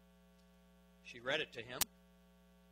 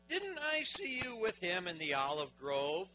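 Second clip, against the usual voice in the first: slow, loud, raised-pitch accusation; accusing challenge; 5 semitones higher, 6 dB louder, slower than usual. Acted. Servant's relative challenging Peter at the campfire, accusing. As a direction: confront him with a pointed question.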